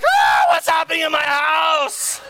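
high, drawn-out mock shriek; frazzled parent's scream. Parent facing chaos at home, screaming. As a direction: scream in comic despair at the chaos.

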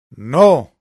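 grito fuerte y agudo. A narrator's reading. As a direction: grita con rabia de niña. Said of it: "gritó Ágata". (un grito fuerte)

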